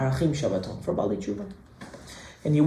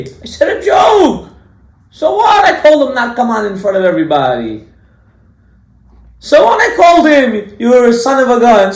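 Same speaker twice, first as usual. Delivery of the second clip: high-pitched shouted protest, words stretched; indignant self-defense; 11 semitones higher, 11 dB louder than usual. Acted. Offender excusing his name-calling to everybody, indignant shouting. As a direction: yell mock excuses at full volume.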